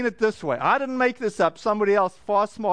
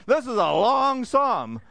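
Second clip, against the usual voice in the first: slow, drawn-out "long"; dawning realisation; 3 semitones higher, slower than usual. Acted. Bible-plan reader still flipping pages, slow mock amazement on "long". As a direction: stretch "long" out as it sinks in. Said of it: "and you flip another page".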